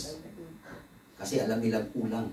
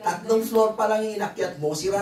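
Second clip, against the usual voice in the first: laughing teasing; 10 semitones higher, 3 dB louder than usual. Real, not acted. Teasing the tired member, laughing.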